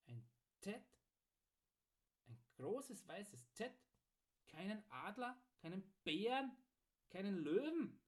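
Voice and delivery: halting, high-rising questions; puzzled smith, pitch lifting on every phrase; puzzled questioning. A narrator's reading. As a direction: query each item in disbelief. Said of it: "murmelte der Schmied fragend".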